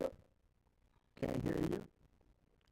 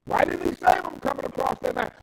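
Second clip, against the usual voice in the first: preaching at full force; 10 semitones higher, 11 dB louder than usual. Acted. Preacher voices God's anger at greed, loud full-force preaching. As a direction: thunder God's wrath from the pulpit.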